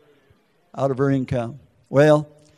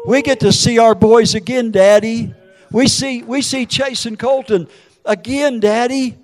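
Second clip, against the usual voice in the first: raised, louder, insistent voice; daughter's urgent consolation; 6 semitones higher, 6 dB louder than usual. Retold or imitated. Daughter telling Daddy it's not over, urgent reassurance. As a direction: lift it high and strong to comfort Daddy.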